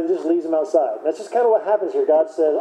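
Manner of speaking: ordinary delivery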